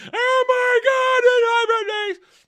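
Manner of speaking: put-on shrieked high and loud, slowly, like a panicking kid; mock panic. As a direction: ham up the kids freaking out. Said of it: "hyperspace going".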